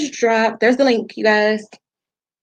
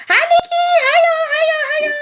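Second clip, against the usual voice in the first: squeaky greeting; 3 dB louder than usual. Real, not acted. Host greets viewers joining the live, high squeaky hello. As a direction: greet them in a tiny, far higher voice.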